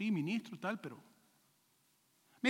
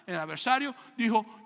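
normal talking voice

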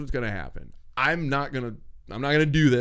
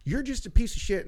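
angry retort; 7 semitones higher than usual. Retold or imitated. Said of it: "it's just like".

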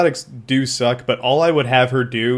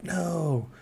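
whispered; 6 semitones higher, 9 dB quieter than usual. Retold or imitated. Pleading with character.

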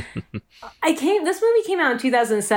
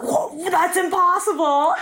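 mock shocked; 6 semitones higher, slower than usual. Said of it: "Ben Gates was like".

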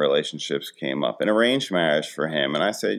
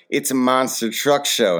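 higher, louder retold exclamation; mock-insistent dad answering the kids; emphatic dad reply; 8 semitones higher, 5 dB louder than usual. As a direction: exclaim it as the obvious explanation. Dad retorts to puzzled daughters.

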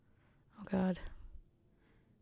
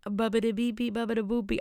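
mock Italian; 2 semitones higher than usual. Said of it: "I could do that 'cause I'm Italian".

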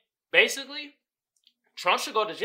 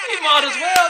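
louder and higher on the repeat; shouted repeat; 9 semitones higher, 9 dB louder than usual. Shown as a demonstration. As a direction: yell it back the second time.

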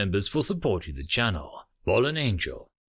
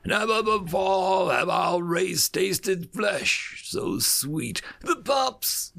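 slow, higher gloating over the taste; relishing predator; 9 semitones higher, slower than usual. A narrator's reading. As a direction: savour every word like a meal. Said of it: "lips drew back from his fangs and a snarl".

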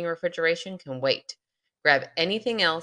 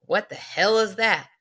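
put-on country accent; 5 semitones higher, 7 dB louder than usual. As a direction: say it like a country dad. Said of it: "in his thick country accent asked".

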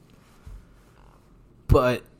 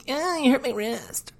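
mocking whine. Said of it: "and then she".

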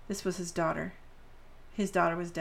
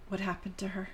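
shaky voice; 5 dB quieter than usual. Acted. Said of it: "with a shaky voice".

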